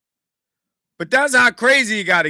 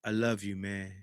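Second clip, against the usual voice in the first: quiet, low, tender "hey man"; soft affectionate; 10 semitones lower, 15 dB quieter than usual. Retold or imitated. Asking viewers about telling their homies, soft and tender. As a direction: hush your voice like telling a homie you love him.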